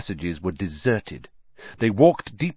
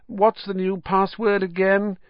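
put-on much higher, offhand young snob; lazy arrogant nobleman; 10 semitones higher than usual. A narrator's reading. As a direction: ask as if bored. Malfoy voiced, offhand.

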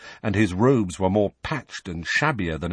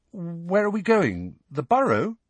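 hopeful; 8 semitones higher than usual. A narrator's reading. Harry asking Lupin where they are going, hopeful. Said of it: "Harry asked hopefully".

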